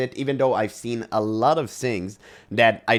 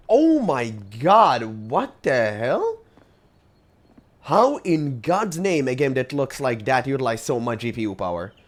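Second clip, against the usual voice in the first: stunned disbelief; 3 semitones higher, 5 dB louder than usual. Real, not acted. Streamer shocked at how hot his PC runs, stunned disbelief. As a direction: gasp it out like you can't believe the numbers.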